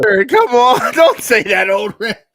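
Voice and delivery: loud laughing protest at a friend. Real, not acted. Host laughing loudly at his co-host's teasing joke, loud laughing protest. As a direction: laugh and shout don't say that.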